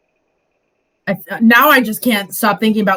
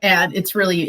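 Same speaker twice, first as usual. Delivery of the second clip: usual speaking voice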